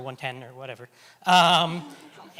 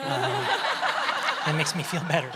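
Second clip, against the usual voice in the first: laughing; 3 semitones higher, 4 dB louder, much slower than usual. Real, not acted. Laughing off nervous joke.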